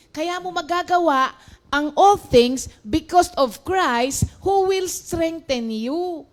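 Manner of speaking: ordinary delivery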